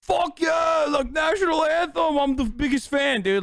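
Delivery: high, shouted mock enthusiasm; over-the-top fanboy hollering; rabid fan impression. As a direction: imitate a friend going nuts for his favorite act. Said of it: "here's Austin just like".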